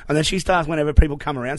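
ordinary delivery

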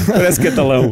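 laughing. Real, not acted. Host still laughing.

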